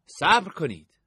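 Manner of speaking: loud, firm call to halt; young man halting the caravan. A narrator's reading. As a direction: stop them from behind the crowd. Stranger stops them.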